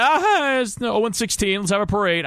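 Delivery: a bright, high, sarcastic shrug; mock cheerful shrug. Fan mocking a carefree reaction to a winless season, sarcastic cheer. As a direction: brush off the loss with fake cheer. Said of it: "Just like".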